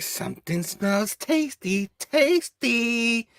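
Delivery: high sly monster voice; creepy creature voice. Acted. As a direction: drool over the word tasty. Vermin creature sniffing prey.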